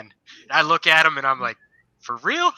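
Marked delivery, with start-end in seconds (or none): 2.0-2.5 high, incredulous question; mock disbelief at the sergeant; a soldier doubting the sergeant, high and incredulous. Disbelief at smoking drill sergeant.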